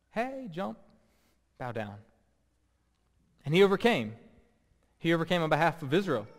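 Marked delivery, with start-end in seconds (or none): 0.1-2.0 a hint of a sly serpent, softer; lightly voiced tempting serpent, quietly; serpent tempter. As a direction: coax him softly like the tempter. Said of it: "the voice of the serpent entered into his ear".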